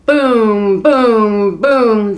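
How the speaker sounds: loud booming rumble like thunder; thundering booms. A narrator's reading. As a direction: boom it out like distant thunder. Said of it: "rumbled like thunder".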